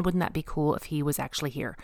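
usual speaking voice

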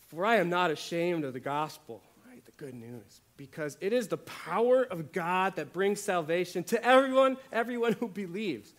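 loud, rising declaration of the verse; proclaiming scripture. Acted. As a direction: proclaim Paul's words boldly. Quoting Paul forcefully.

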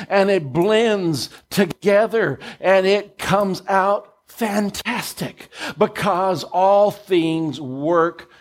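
slow, high drawn-out refrain; preaching refrain. Acted. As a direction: lead the crowd in the refrain.

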